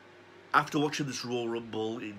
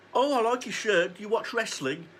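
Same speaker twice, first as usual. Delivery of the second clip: much higher, chirpy female voice; shop woman's voice; 9 semitones higher than usual. Retold or imitated. Shop clerk asks fan about his shirt, high mock woman's voice. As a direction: put on a bright cashier's voice. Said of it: "and she says".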